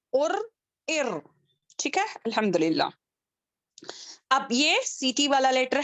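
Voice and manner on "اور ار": ار with a rolled r; trilled r. Shown as a demonstration. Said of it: "تو ار".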